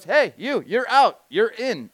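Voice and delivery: loud, high referee-style shout; calling out. Retold or imitated. Man with whistle picking players at pickup game, calling out. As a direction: bark out orders to players.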